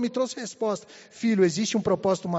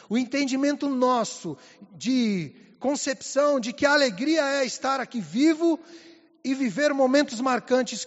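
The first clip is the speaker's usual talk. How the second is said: raised, higher-pitched sermon delivery; emphatic preaching; 4 semitones higher, 4 dB louder than usual. Real, not acted. Preacher assures the church of his mother's peace, emphatic preaching. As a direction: proclaim it to the congregation with conviction.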